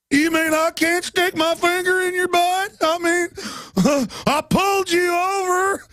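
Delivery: high, slow, indignant cop; outraged cop impression. Host mocking a cop's entitlement, high and slow. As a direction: act offended like a power-mad cop.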